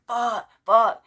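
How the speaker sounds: high, loud mock cries of pain; re-enacted pained shouts; retold screaming. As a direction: yelp each word separately, as if bitten. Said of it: "when I'm screaming".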